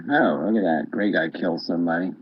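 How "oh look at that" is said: ordinary delivery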